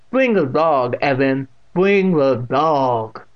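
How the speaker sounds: loud order, last one drawn out; deaf old aunt ordering. A narrator's reading. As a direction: demand it, stretching the repeat.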